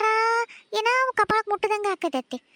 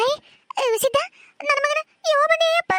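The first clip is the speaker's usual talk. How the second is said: wailing mother; 8 semitones higher than usual. Acted. Mother wails for her son.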